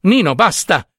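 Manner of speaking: high, irritated outburst; acted peak of irritation; exasperated cry. A narrator's reading. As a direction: cut him off crossly. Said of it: "al colmo della stizza".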